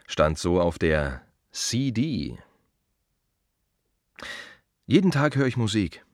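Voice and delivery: usual speaking voice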